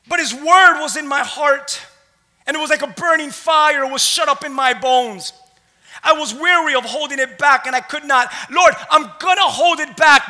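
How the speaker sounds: forceful, punched phrases; impassioned scripture preaching. A narrator's reading. Preacher voices Jeremiah unable to hold back, impassioned. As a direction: preach it with mounting urgency.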